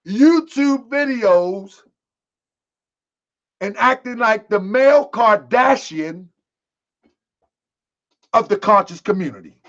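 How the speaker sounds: drawn out slowly, each word weighed; deliberate slow mocking recital; slow emphatic repeat. Shown as a demonstration. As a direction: spell out the charge slowly for emphasis. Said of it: "I'ma say it again".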